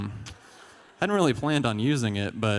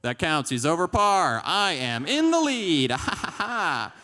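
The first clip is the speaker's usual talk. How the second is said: high, slow, drawn-out victory cry; gloating triumph; 7 semitones higher, 3 dB louder, slower than usual. Acted. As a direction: crow about winning, ending in a cackle. Acted gloating over winning at golf.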